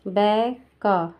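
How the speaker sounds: बै का split slowly into two beats; syllable by syllable. Shown as a demonstration. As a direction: sound out बै then का, slowly. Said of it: "B के बाद".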